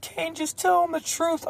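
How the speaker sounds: high-pitched mock mother voice; imitated mom. Mom urging her son to confess to the cop, high mock-mother voice. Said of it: "And my mom's just".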